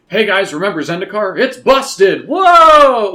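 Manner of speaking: mock announcer selling it hard; overexcited ad-pitch parody; hammed-up hype. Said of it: "I don't think they wanna be like".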